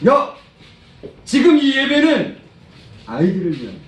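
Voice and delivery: acted loud, high rebuke; angry Jesus. Jesus correcting adults who sideline children in worship, acted anger. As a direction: raise voice and pitch in stern correction. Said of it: "예수님이 엄청 노하실 거예요".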